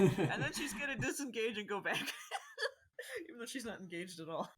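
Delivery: giggling through the words; laughing while talking. Real, not acted.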